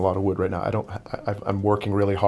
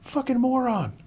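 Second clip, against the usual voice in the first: high, strained mock yell; imitated angry yelling; 4 dB louder than usual. Imitating a kid yelling at a masked runner, mock furious. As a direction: play an irate heckler yelling from far off. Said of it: "he's yelling at me".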